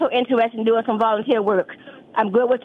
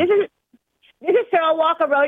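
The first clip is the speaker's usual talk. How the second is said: raised, higher voice over a bad line; to someone hard of hearing; 6 semitones higher than usual. Real, not acted. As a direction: call out to be heard on the phone.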